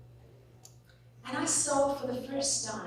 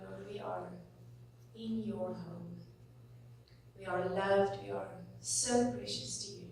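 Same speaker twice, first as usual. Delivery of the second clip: heartfelt prayer; 4 dB quieter than usual. Real, not acted. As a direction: pray it sincerely, leaning on each thanks. Pastor prays aloud to God.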